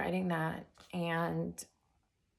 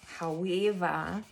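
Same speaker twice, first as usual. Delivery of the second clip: a slow, drawn-out Australian "However," a bit louder; Australian accent; 5 dB louder, slower than usual. Shown as a demonstration. Vlogger showing off her Australian accent on "However,". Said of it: "that's my Australian accent".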